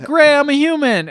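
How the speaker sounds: put-on boy turned back from mouse calls to grandma, high and excited. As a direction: burst out with childish delight. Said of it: "And he's like".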